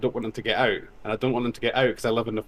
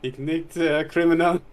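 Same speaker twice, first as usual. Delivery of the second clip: broken German; 5 semitones higher, 3 dB louder than usual. Retold or imitated. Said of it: "Try and communicate that in German".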